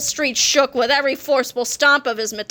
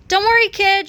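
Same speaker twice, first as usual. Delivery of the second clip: high, louder call; shouted reassurance; 8 semitones higher, 5 dB louder than usual. A narrator's reading. George calls to his frightened family, shouting. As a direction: call out over the noise. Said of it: "shouted George".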